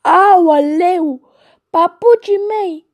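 high, loud wail over lost slippers; wailing gander. Acted. A gander wailing to the geese over his slippers. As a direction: cry it out high like a distraught gander. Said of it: "auzind cum zbiară".

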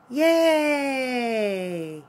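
big happy yay; cheer. Real, not acted. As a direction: celebrate the end of the song. Cheers after song.

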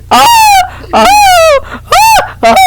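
long high scream as he drops; screaming while falling. Real, not acted.

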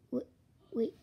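normal talking voice

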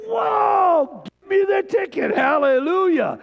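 very high, loud gleeful yell; mock greedy shout. Preacher mocking a greedy grumpy man, high loud yell. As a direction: grab for the ticket in glee. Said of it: "It's like".

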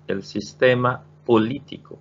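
El sistema político spoken slowly and clearly; slow model reading. Shown as a demonstration. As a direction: say it slowly so students repeat. (نطق بطيء وواضح)

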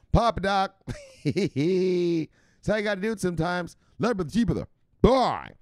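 high, loud hype-man shoutouts; high, loud streamer hype calling out names. Real, not acted. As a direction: pump up the chat like a hype man. Hyped shoutouts to chat.

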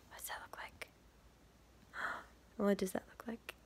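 hushed suspense; whisper. Real, not acted. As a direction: whisper to build the mystery. Hushed suspense over page.

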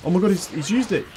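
loud, high panicked outburst; alarmed exclamation. Real, not acted. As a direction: sound genuinely alarmed. Boss uses Death Ball.

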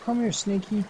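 put-on baby talk. Calling to the snake.

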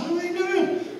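very high puzzled ask; astonished question. Retold or imitated. Preacher asking about the clicking speakers, high and baffled. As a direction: sound truly baffled. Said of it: "I said".

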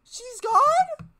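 very high, loud gasp of disbelief; can't believe she's gone; shocked disbelief. Real, not acted. Shocked at character's death.

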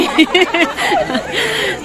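high laugh. Real, not acted. Greeting the big group of kids, laughing.

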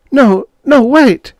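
very high, loud, desperate call; urgent outcry. A narrator's reading. Wife rushes after the young man, urgent outcry. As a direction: cry out to stop him leaving.

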